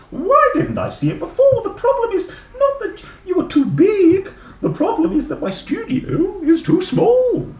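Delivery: very high, loud, laughing; a teacher struck by a bright idea; delighted realization. Acted. Teacher tells the class her idea, high and laughing.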